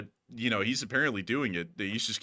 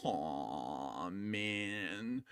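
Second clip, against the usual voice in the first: low, soft mock whimper; a fake sad sigh; mock whine; 4 semitones lower, 11 dB quieter than usual. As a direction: pretend disappointment, soft and low.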